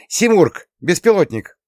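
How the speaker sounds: high, loud alarm call; urgent shout. A narrator's reading. Answering who is here, shouting the drone's name urgently.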